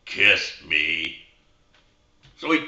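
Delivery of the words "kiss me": much lower, deadpan frog voice; a low, slow little frog calling out the club; talking frog. Acted. Golfer hears a frog suggest a club, much lower frog voice. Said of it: "and the frog goes".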